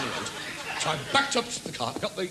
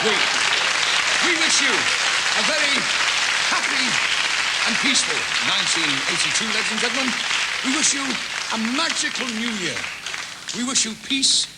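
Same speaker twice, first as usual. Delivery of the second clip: formal greeting; 5 semitones higher, 6 dB louder than usual. Acted. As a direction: announce it warmly to the whole room. Formal New Year greeting, slowed.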